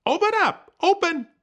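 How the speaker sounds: very high, loud yelling; frantic shrieking command; furious shout. Acted. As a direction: scream at the tree to open. Coyote yells at the tree.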